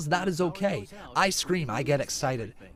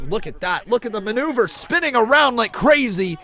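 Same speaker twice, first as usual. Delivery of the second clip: excited call, climbing higher and louder; live wrestling play-by-play; 8 semitones higher, 8 dB louder than usual. Real, not acted. Streamer reacting to a spinning maneuver, excited play-by-play. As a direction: marvel at the move as it happens.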